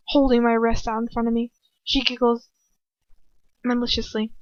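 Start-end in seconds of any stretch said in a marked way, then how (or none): none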